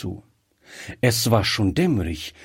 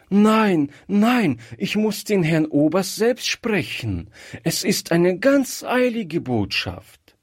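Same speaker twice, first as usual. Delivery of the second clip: urgent insistence; 8 semitones higher than usual. A narrator's reading. Gretchen insists on urgent message.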